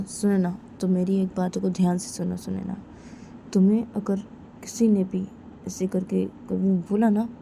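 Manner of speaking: ordinary delivery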